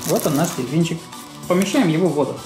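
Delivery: ordinary talk, nothing marked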